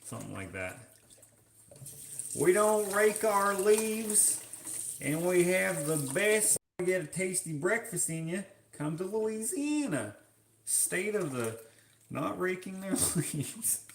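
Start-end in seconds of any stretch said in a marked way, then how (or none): 2.4-13.8 put-on Louisiana twang, breaking into a laugh at the end; Louisiana accent. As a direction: pitch it like a proud local in a mock Louisiana accent. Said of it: "I have no idea what you guys sound like".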